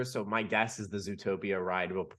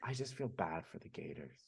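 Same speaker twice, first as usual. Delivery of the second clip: whisper; 6 semitones lower, 13 dB quieter than usual. Real, not acted. Unvoiced aside.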